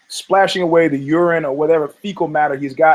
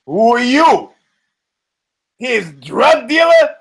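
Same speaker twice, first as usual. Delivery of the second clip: higher and louder mock heckle, slowed; retold heckler yelling, drawn out; heckler shout; 8 semitones higher, 4 dB louder, slower than usual. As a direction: bellow the taunt from the stands. New York hecklers yell at pitcher.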